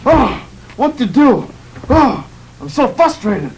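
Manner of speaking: usual speaking voice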